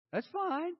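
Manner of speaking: ordinary delivery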